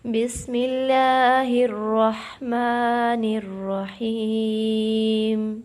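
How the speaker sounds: slow, loud melodic tajweed chant; Quran recitation. Acted. Teacher recites for students in slow chant. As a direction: draw every syllable out like a reciter.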